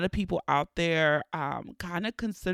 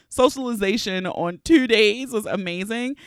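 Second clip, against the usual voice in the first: normal talking voice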